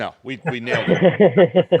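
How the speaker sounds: laughing through the words, slowly. Real, not acted. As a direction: chuckle it out proudly. Laughing at their clumsy display.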